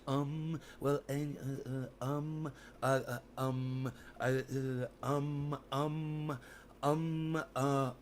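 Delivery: soft, stalling string of ums and uhs; Elon Musk impression. Streamer keeps mocking Elon for chat, soft stalling ums. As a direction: stall for thoughts like a mocked genius. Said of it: "Is that a good impersonation of him?".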